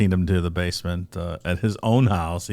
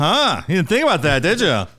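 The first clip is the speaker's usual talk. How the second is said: high, loud mocking gloat; smug teasing; 10 semitones higher, 5 dB louder than usual. Real, not acted. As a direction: gloat at him loudly.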